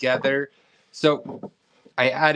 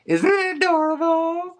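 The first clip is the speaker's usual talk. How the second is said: cutesy high voice; 4 dB louder than usual. Acted. Cooing over cute figurine.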